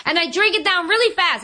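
usual speaking voice

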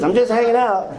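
high, hammed-up breezy claim; put-on casual lightness; fake nonchalance. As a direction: mimic a newcomer pretending all is well. Mimics newcomer hiding his desperation.

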